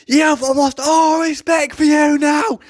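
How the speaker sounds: high, loud mock-angry shouting; mock the livid copper's rant; hammed-up outraged yell; imitating furious copper. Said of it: "So he goes".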